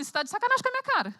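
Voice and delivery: fast, high, incredulous retort; exasperated disbelief. Pretend. Preacher imagining herself in Mary's place, fast incredulous retort. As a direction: snap back as if someone is kidding you. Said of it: "eu ia virar e falar assim".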